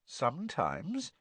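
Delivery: slow, soft and sly; slow sly tease. A narrator's reading. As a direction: drawl it slyly. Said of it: "said Myrtle rather slyly".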